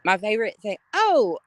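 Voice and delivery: usual speaking voice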